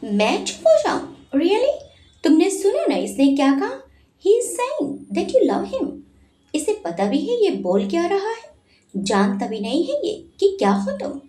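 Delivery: lightly acted jealous outburst; angry. A narrator's reading. Manav protesting to Dhara, mildly angry. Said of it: "मानव गुस्से में बोला".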